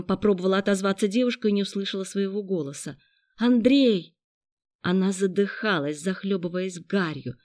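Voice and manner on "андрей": high, loud cry of a name; urgent higher-pitched shout; desperate call. A narrator's reading. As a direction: call out for help through smoke. Girl calls out in smoke.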